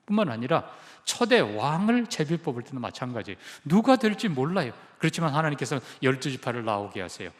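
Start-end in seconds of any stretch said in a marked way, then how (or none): none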